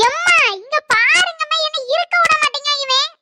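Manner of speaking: put-on tattle on him like a child; whiny complaint. Daughter crying out to mother about her brother, whiny plea.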